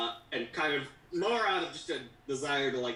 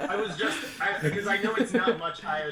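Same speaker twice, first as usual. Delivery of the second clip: higher, louder talk through laughter; laughing; 8 semitones higher, 6 dB louder, faster than usual. Real, not acted. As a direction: explain while still laughing, a bit fast. Laughing at herself.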